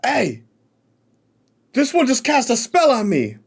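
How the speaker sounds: high, excited accusing shout; excited outburst. Acted. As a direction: yell it out, startled and accusing. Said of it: "he's like".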